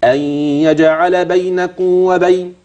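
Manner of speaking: بينكم وبين slow, meem kept distinct before وبين; إظهار الميم قبل الواو في بينكم وبين; meem concealed before waw. Shown as a demonstration. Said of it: "إخفاء".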